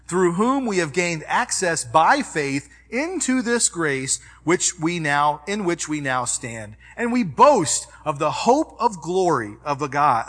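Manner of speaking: ordinary delivery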